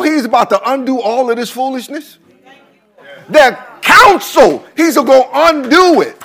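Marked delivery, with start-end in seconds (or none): 3.3-6.2 higher, louder preaching shout; full-force preaching. Real, not acted. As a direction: drive it home to the church.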